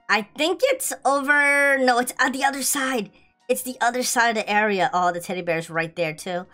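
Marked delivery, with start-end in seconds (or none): none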